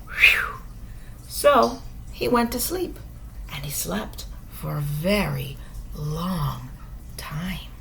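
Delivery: plain reading voice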